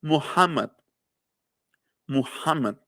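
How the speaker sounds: Muhammad with a throaty kh, /muxama/; Arabic Muhammad. Shown as a demonstration. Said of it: "llevan este fonema".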